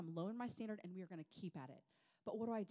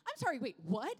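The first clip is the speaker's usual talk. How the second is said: loud, high disbelief; acted incredulous outburst; retold shock; 6 semitones higher, 11 dB louder than usual. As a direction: blurt it out, stunned, hammed-up. Retold shock at bad news.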